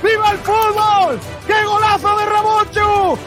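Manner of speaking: screaming goal call, stretched gol gol gol; breakneck goal commentary. Real, not acted. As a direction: roar the goal at full voice. (narración de gol desbocada)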